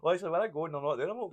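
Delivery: ordinary talk, nothing marked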